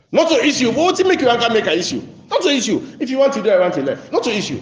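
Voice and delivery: high-pitched, excited protest, acted out; animated dismissal. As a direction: wave it away as no issue at all. Acting out people dismissing the matter.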